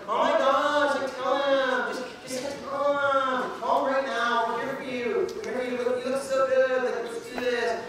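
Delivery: high and excited, urging; cheering girlfriends. Retold or imitated. Friends urging the girl to call her crush, high and giddy. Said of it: "all of her girlfriends was like".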